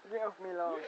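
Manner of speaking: quiet mutter. Pretend. Quiet repeat of the lawn line.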